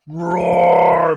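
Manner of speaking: loud dragon bellow; bellowed roar. Acted. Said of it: "bellowed the dragon".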